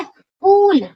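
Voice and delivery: पूल said slowly with a long ऊ; teacher showing the long vowel; long oo demo. Shown as a demonstration. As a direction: stretch the ऊ in पूल. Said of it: "वो है हमारे पास tool".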